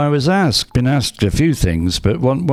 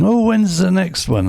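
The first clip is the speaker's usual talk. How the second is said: eager listeners imitated; 11 semitones higher than usual. Retelling eager listeners' question.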